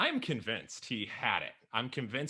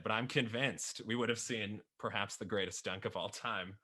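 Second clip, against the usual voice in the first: normal talking voice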